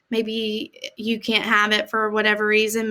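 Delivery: ordinary delivery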